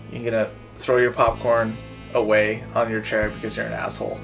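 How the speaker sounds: ordinary delivery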